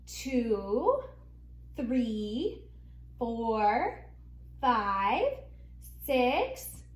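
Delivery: normal talking voice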